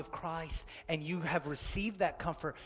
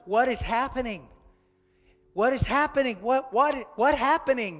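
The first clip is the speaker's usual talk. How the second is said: put-on high, loud frightened little boy; scared child; 8 semitones higher, 11 dB louder than usual. Toddler asking his father in panic, high and scared.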